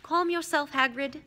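mildly sharp order to settle down; understated firm reproof; Dumbledore, sharp. Acted. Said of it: "said Dumbledore sharply".

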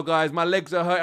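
usual speaking voice

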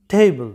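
টেবল as TAY-bul, puffed t, dark final l; correct pronunciation. Shown as a demonstration. Teacher corrects students' word, correct pronunciation. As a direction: model টেবল in English, falling. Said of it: "এইটার উচ্চারণটা হবে".

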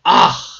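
loud, sudden "ah" of frustration; frustrated yell. Real, not acted. Gamer fed up with fumbling words, frustrated yell. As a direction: let out a short exasperated yell.

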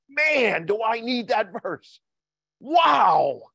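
high, loud burst of wonder; like the verse just hit you hard; amazed exclaim. Retold or imitated. Churchgoer reading the daily verse, struck and amazed. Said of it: "sometimes we see that daily verse and we go".

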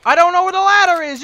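yelling. Real, not acted. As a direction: yell it in exasperation. Frustrated yelling at teammate.

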